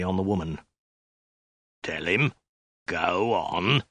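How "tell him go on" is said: menacing prompt. A narrator's reading. Soldier with a poker prompting the woman, menacing. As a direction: prompt them under your breath, menacingly.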